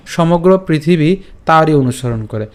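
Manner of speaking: usual speaking voice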